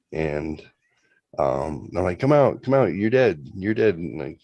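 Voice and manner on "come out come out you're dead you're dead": high, loud taunting call; playful kid taunt, loud and high. Retold or imitated. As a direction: shout him out of hiding. Calling brother out in game.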